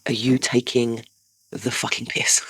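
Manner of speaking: hissed under breath; furious whisper at a late son; whispered outrage. Retold or imitated. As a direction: whisper it in disbelief. Mum confronting son late home.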